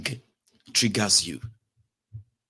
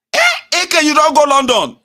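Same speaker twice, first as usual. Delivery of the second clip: loud, high disbelieving shout; shocked shout; 10 dB louder than usual. Retold or imitated. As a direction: yell it in disbelief.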